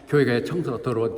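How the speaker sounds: ordinary talk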